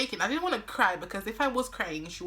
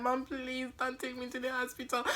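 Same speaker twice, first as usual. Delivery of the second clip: mock pleading; 5 semitones higher, 5 dB quieter than usual. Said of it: "And I'm like".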